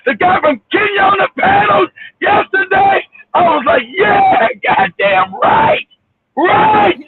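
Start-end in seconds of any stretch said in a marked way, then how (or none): 4.0-7.0 high, drawn-out whoop of approval; emphatic cheering agreement. Retold or imitated. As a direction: cheer him on slowly, pitched up. Retelling own cheering agreement.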